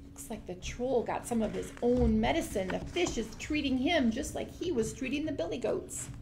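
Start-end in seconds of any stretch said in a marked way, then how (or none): none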